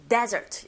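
desert stressed on the first syllable, DE-sert. Shown as a demonstration. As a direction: punch the DE in desert. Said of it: "첫 번째 강세 주시면 됩니다". (desert 첫 음절에 강세)

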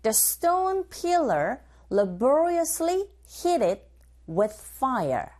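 slow, careful drill reading; teacher modelling the phrase slowly; slow model line. Shown as a demonstration. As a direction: say it slowly for students to copy. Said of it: "고고 listening two".

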